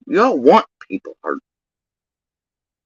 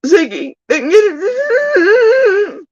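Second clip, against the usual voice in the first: much higher, slow, drawn-out fake sob; mock wailing; 3 dB louder, much slower than usual. Ridiculing Ziggy over the lost interview with a slow mock wail. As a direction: stretch it into a pitiful wail.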